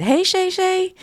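jumps up high to mimic the catchphrase; high playful imitation of a catchphrase; Shay's catchphrase. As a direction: toss it off like a playful shout-out. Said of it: "Like Shay said".

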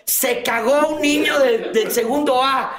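burla aguda y lenta, como coro de niños; burla cantada. Retold or imitated. Repite la burla de compañeros. (cantaleta burlona, aguda y lenta)

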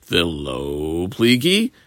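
slow, sceptical retort rising sharply at the end; incredulous challenge. Acted. Abaye challenges Rava in retort.

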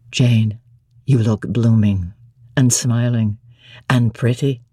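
low, deep-voiced master; deep, commanding lover's voice; Rochester. A narrator's reading. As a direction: drop low into a gruff gentleman.

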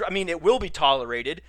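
usual speaking voice

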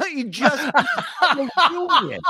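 high laughing disbelief; cracking up, voice pitched high. Real, not acted. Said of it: "Oh, God".

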